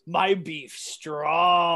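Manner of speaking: very slow, drawn out; slow deadpan. Retold or imitated. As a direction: stretch each word slowly. Quoting movie line.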